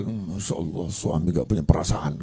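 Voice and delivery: wife grumbling about her husband in the dark, low mutter; muttered complaint. Retold or imitated. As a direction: mutter it so the husband can't hear. Wife complaining under her breath.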